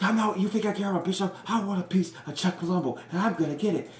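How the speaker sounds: high, loud, heated yelling; fired-up wrestler cutting a furious rant; angry outburst. Retold or imitated. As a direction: snap back hot with anger. Edge furious at Benoit backstage.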